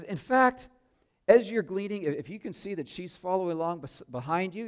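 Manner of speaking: high and loud, rushing to explain; eager boss giving orders; eager instruction. Retold or imitated. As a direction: boss keen to help her. Boaz eagerly orders the workers.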